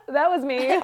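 sheepish laughing admission. Retold or imitated. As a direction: laugh it out, caught red-handed. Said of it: "I was like".

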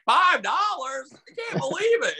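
high-pitched squeal of disbelief, slow; excited disbelief. Retold or imitated. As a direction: gush like a starstruck fan. Excited fan at sold out show.

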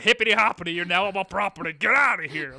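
loud, high mock rant at a trespassing kid; mock angry neighbour. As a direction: shoo the kid off your lawn in a hammed-up yell.

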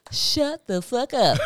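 ordinary talk, nothing marked

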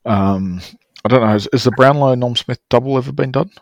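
ordinary talk

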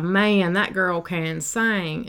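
slow Southern drawl, stretching sing; lightly voiced Southern neighbor, slow and admiring. Mom quoting Southern locals praising her daughter, slow Southern drawl. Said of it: "like, Southern, like these people".

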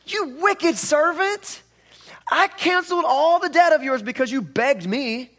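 loud, high, slow scolding; furious rebuke. Acted. King scolding the wicked servant, furious and loud. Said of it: "So this is what he says".

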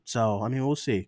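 usual speaking voice

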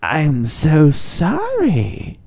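slow, whispered apology; breathy whisper. Retold or imitated. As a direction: whisper it very slowly as the frozen clerk. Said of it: "Just like that".

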